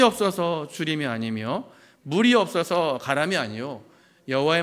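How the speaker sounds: slow, measured Bible recitation; scripture reading. Real, not acted. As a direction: lead the congregation reading the verse aloud, slowly. Leads congregation in reading scripture aloud. (천천히 힘주어 성경 봉독)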